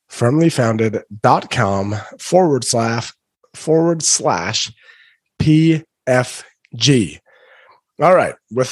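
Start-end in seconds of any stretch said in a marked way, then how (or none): 0.1-7.2 slow spelled-out dictation. Shown as a demonstration. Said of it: "repeated web address".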